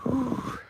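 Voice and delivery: high-pitched relieved whew. Real, not acted. Nearly tripped on stairs.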